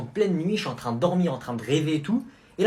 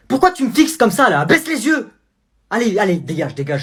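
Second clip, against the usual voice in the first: loud, higher furious mom; angry mother; 6 semitones higher, 9 dB louder than usual. Acted. Mother yelling at her son over his grade. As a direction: demand an answer about the grade.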